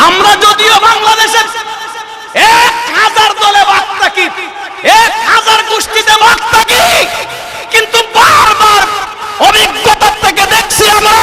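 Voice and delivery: very high sermon shout; rally the crowd at full voice; preaching at full force. Acted.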